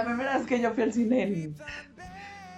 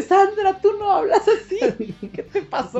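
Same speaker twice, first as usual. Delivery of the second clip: high, slow wail through laughter; hammed-up dismay with giggles; laughing mock plea; 11 semitones higher, 3 dB louder, slower than usual. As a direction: beg Sandra half laughing. Laughing plea to Sandra Bullock.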